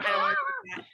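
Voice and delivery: high, reluctant child's protest, imitated; whiny kid. Mom imitating her son refusing the dare, high whine. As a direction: mimic a shy boy balking.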